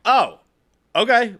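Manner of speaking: high, loud surprised oh okay; startled, caught off guard; a sudden taken-aback okay. Retold or imitated. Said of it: "And I was like".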